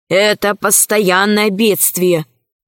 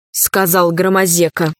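usual speaking voice

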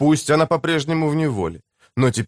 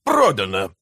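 lower, decisive one-word close; curt finality; 6 semitones lower than usual. A narrator's reading. Mayor ends the bargaining with the slave trader, curtly. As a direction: cut off the haggling, low. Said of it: "отрезал Пенниройл".

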